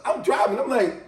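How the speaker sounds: ordinary delivery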